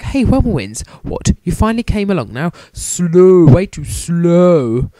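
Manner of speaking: rival taunting. Retold or imitated. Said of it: "and he's like".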